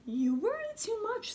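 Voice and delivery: high, chiding mother; mother's voice. A narrator's reading. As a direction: scold gently like a fussing mum. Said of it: "said her mother".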